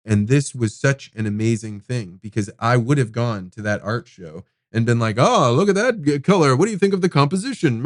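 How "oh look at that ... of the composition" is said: higher, quick and showy, like gallery small talk; mock-sophisticated chatter about the painting's colour and composition; trying to sound impressive. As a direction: play the eager date faking art expertise. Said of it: "trying to be impressive".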